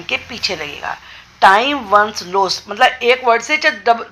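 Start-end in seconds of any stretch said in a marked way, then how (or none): 1.4-2.7 very slow, word by word, dictating Time once lost; slow dictation. Shown as a demonstration. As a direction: spell it out slowly for students to write. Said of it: "dictating the English translation". (बहुत धीमे, शब्द-शब्द बोलकर)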